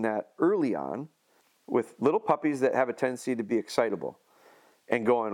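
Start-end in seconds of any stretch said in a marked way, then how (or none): none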